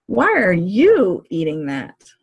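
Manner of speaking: slowly single out the word "you"; stress on YOU. Shown as a demonstration. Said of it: "to stress a different word in the sentence".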